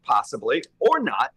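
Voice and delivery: usual speaking voice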